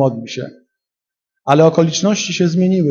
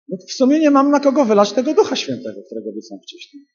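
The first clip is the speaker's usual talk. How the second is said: mock Jesus musing; 8 semitones higher, faster than usual. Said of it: "Tak Jezus myślał".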